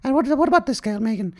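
high, posh-put-on old queen; a falsetto queen impression, lightly hammed; mock Queen voice. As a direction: play the Queen, high and prim. Queen voice continues.

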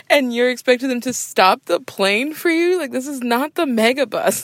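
mock the latecomer through laughter; laughing disbelief. Real, not acted. Host mocking late passengers, laughing in disbelief. Mocking her mom's airport meltdowns.

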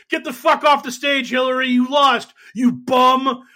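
loud, high mocking yell; jeering heckler. Crowd jeering at Hillary, loud high heckling shout. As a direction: boo her off the stage like a heckler. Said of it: "everyone's like".

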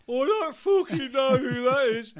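high, very loud baffled bellow; exasperated shout. Acted. Singer hearing an unknown name in the van, exasperated shout. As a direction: bellow your ignorance, fed up.

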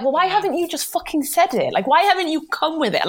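high-pitched imitation of a complaint; mock exasperated protest, pitched high; mock indignant. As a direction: whine it high, as if put out. Mimics the indignant reaction of partners.

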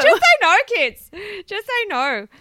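high, loud slogan; mock PSA shout. Podcaster warning kids off drugs, mock PSA shout. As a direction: belt it out like a jokey warning.

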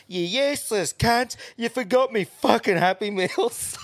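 hammed-up screaming customer; imitating a raging woman storming back in; mock furious woman. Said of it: "steaming back in and goes".

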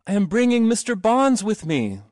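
high, loud sustained call; shouted. A narrator's reading. Boy announcing his guest to the gate, shouted. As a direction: announce it to everyone far off, ringing out. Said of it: "he shouted".

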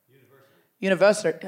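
a go at an American "University"; attempted American R. Shown as a demonstration. Comic tries saying it for an American, attempted American accent. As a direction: try the American R in "University", not quite managed. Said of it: "I can't do the R's".

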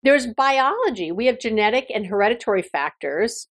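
usual speaking voice

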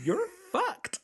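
high, laughing mock verdict; laughing punchline. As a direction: deliver the punchline cracking up.

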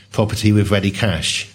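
ordinary talk, nothing marked

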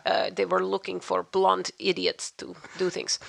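slow mock Russian accent; deliberate, comic Russian-accented delivery. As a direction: play it like a Russian spy story. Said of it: "you know, a Russian".

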